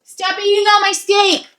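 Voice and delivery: mock scolding. Playful high scolding.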